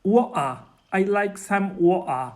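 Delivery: "Water" punched out as wo'-ah, t dropped; Cockney glottal stop. Shown as a demonstration. Correcting the hosts' attempt, emphatic glottal-stop "water". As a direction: model "water" firmly with a catch instead of t. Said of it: "Try it again".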